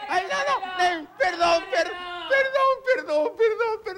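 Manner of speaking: high, whimpering pretend sobs; mock crying plea. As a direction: beg for mercy, fake crying. Begging, pretend crying in fear.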